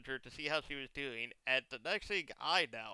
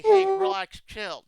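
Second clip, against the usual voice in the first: mock placating; 15 dB louder, slower than usual. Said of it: "when you say".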